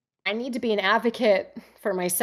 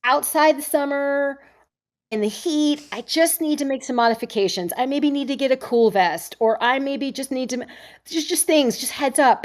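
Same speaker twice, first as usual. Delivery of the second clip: polite request; 6 semitones higher than usual. Retold or imitated. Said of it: "I was just like".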